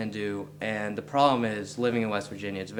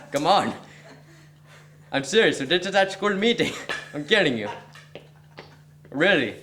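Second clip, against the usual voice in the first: put-on high, loud joking Indian accent; 7 semitones higher, 4 dB louder than usual. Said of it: "I can do an Indian accent".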